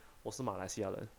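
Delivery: usual speaking voice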